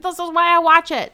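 high, loud, delighted exclamation; thrilled viewer. Acted.

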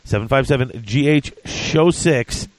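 usual speaking voice

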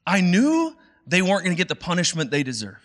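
bitter Jonah. Retold or imitated. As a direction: throw the accusation back at God. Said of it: "Jonah's like".

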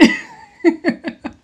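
breathy high laugh breaking out; high burst of laughter. Real, not acted. Laughing at Adam Warlock being an idiot, high laugh. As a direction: let a high laugh escape.

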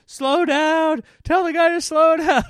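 put-on very high, loud frightened child's plea; scared little girl. Scared little daughter on boat.

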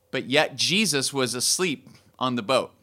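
ordinary talk

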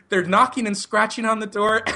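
high-pitched retold emergency report; mock-panicked, high and careful; nervous high voice. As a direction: pitch it up like a rattled caller. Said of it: "ten octaves higher than it normally is".

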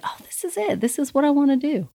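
high, bright exclaim of discovery; imagined eureka. Pretend. Host voices imagined eureka moment.

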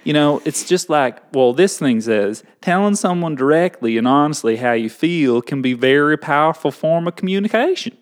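reading aloud with a Southern twang; Southern accent. Pretend. Reading the rule to co-host, Southern accent. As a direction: recite it as homespun Southern advice. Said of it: "do it in a Southern accent".

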